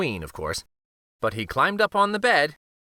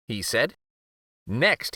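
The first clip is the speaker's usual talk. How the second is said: usual speaking voice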